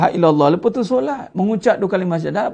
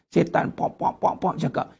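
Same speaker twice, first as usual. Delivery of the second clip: hushed, unvoiced whisper of a tempting devil; sly devil whispering gossip; 4 semitones lower, 7 dB quieter than usual. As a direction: whisper it like Satan in her ear.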